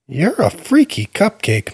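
put-on high, cheeky child's voice; cheeky little boy. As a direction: tease him like a fearless four-year-old. Boy teases the king.

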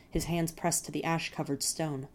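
usual speaking voice

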